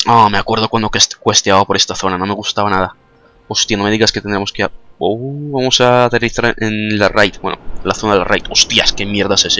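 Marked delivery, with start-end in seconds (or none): none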